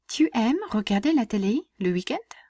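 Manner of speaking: "Tu aimes regarder la télé le week-end" lifting up on "week-end"; rising question intonation. Shown as a demonstration. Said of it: "make my voice go up at the end".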